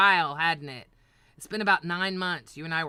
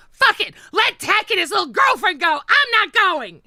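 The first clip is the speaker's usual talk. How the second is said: furious high shouting, much louder; retold tantrum; 12 semitones higher, 12 dB louder than usual. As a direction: scream it out in a childish rage. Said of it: "I had a temper tantrum".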